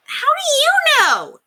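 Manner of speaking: high, loud shout, slow. A narrator's reading. Boy shouting at the housekeeper who's leaving, high and loud. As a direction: yell it at her across the table. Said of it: "he shouted".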